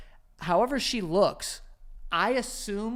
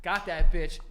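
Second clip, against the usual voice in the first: usual speaking voice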